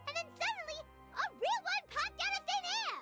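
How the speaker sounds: high, excited climax to a story; amazed retelling. Acted. Companion tells of a real vision appearing, amazed. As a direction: burst out with the amazing part.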